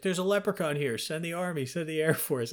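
high-pitched, pleading mock alarm; retold high-pitched call for backup; urgent alarm call. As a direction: imitate someone frantically reporting a sighting. Ozzy's alarmed call to police.

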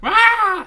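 put-on high, loud "rah" of excited kids; sudden high-pitched mock yell; mock crowd cheer. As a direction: imitate screaming young concertgoers. Imitating kids at the concerts.

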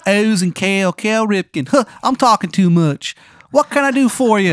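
high, loud, bad Maryland accent; Shithead Steve's terrible Maryland accent. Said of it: "It's like a bad accent".

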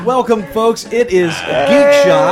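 loud, slow show-host welcome; announcer opening. Acted. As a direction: boom out the show name like an announcer.

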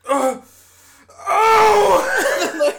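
high yelping groan, pushed out loud; pained stretching groan. Retold or imitated. Roommate hears the stretch, then a loud pained yelp. Mock pain while stretching.

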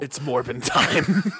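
slow, drawn-out meme line; slow hammed-up meme quote; mock Morbius catchphrase. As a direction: drag it out like a movie trailer.

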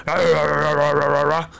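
mocking gibberish. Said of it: "Kevin Garnett makes fun of the way the guy talks".

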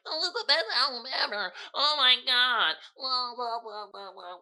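high, gushing mock fan voice. Vlogger mocking [name] fans who loved it live, gushing fan voice. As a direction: mimic an overexcited fan. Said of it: "And motherfuckers like".

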